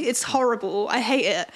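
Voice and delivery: usual speaking voice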